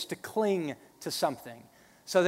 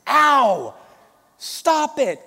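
pained shout; 9 semitones higher, 12 dB louder, much slower than usual. Retold or imitated. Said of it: "my mom goes".

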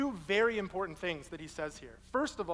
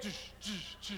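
loopy buzzing noise; 8 dB quieter than usual. Retold or imitated. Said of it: "he started going like".

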